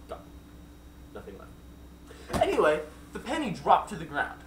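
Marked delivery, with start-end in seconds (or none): 0.1-2.4 slow, spooky whisper; hushed whisper. A narrator's reading. Narrator whispers that the aliens vanished without a trace. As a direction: breathe it out like a ghost story.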